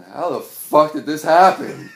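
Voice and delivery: slow, loud, high cry of disbelief. Retold or imitated. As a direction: wail it at the windshield.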